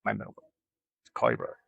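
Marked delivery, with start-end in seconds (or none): 1.1-1.6 lower, mom's firm order; lightly voiced stern mom. Mom tells her to phone her brother, low and firm. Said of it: "she told me which one".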